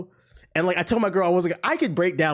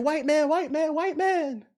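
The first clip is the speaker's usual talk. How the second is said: mock mermaid scream; 10 semitones higher, slower than usual. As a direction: imitate a damsel calling out in a high voice. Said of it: "Fucking Little Mermaid screaming".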